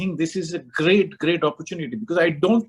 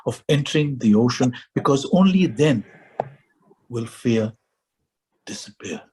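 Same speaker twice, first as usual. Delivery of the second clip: measured recitation with long pauses, sinking lower; poem recitation; 4 semitones lower than usual. Acted. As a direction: recite it gravely, letting each phrase stand alone. Recites a poetic passage.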